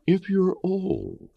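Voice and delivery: slow, high, sugary mock-kind voice; a hint of a patronizing bureaucrat; mock health system. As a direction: coax like a fake-caring official.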